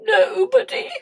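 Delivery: high, self-pitying king; hammed-up whimper. A narrator's reading. King complains to the queen, play-whimpering. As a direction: whimper it like a wounded royal. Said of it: "he whimpered".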